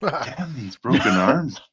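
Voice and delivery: put-on slow, creaky frail old man. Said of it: "Frail old man".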